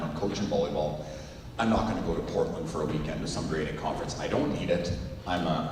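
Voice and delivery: low, soft grumbled list of excuses; mock flat refusal. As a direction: mutter the excuses flatly. Narrator voicing his own refusal.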